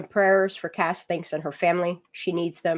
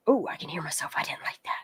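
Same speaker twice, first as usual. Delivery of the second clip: whispering; 2 semitones lower, 7 dB quieter, faster than usual. Real, not acted. Startled by own voice on stream.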